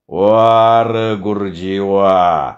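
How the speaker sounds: low, very slow mocking drawl; sarcastic praise. Villager mocks the teacher found away from his school, low sarcastic drawl. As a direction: stretch out each word of false admiration.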